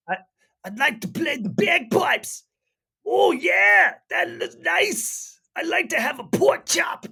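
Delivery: high, loud, hammed-up Scottish voice; Scottish accent impression. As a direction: shout it out in an over-the-top Scottish brogue. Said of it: "You nailed it, brother".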